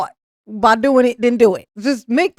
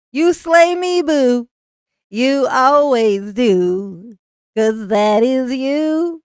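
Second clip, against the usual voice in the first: slow mocking drawl; much slower than usual. Drawn-out taunting sing-song.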